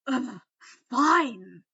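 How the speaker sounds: loud grudging ugh, fine; grumbling. Acted. Malfoy giving in to friends, loud grudging. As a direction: give in with a loud groan. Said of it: "Malfoy grumbled".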